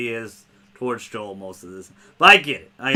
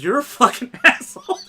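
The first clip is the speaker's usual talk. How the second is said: quoting Ellie high, breaking into a laugh; giggling mock insult, pitched up; laughing through the line; 10 semitones higher than usual. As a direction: start the insult and crack up midway. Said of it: "That's, that's her line".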